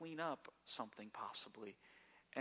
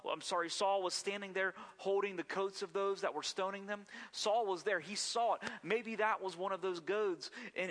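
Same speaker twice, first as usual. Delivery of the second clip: louder, higher preaching surge; preacher rising to full force; building sermon; 4 semitones higher, 4 dB louder than usual. Real, not acted. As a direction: drive the point home to the congregation.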